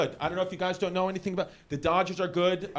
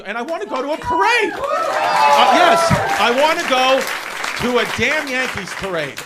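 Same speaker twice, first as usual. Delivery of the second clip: excited outburst; 4 semitones higher, 10 dB louder than usual. Real, not acted. Dreaming of a Yankees parade.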